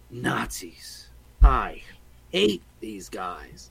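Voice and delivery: low, slow, weary Indy line; a hint of Indiana Jones, low and slow; Indiana Jones quote. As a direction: quote Indy dryly, dragging it out. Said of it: "immortal words of Indiana Jones".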